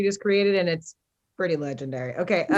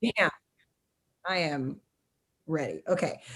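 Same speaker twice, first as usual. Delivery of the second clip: ordinary talk, nothing marked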